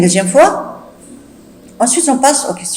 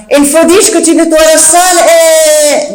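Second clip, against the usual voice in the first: higher, louder exasperated command; shouted order; 6 semitones higher, 5 dB louder than usual. Acted. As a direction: shout over her laughter.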